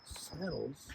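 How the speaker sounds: soft, falling, baffled saddles; quiet disbelief. Retold or imitated. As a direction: mutter saddles as if you misheard. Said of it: "And she says".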